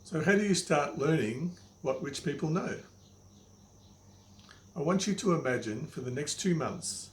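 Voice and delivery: ordinary talk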